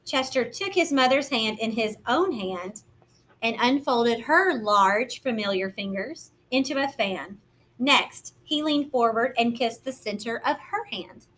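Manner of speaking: gentle, lively picture-book narration; reading to children. A narrator's reading. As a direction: read it like storytime for little ones.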